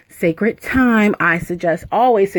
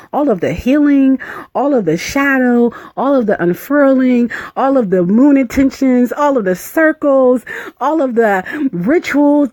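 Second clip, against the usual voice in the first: building fervor; 6 semitones higher than usual. Real, not acted. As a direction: pile up each item with growing joy. Listing rituals with rising fervor.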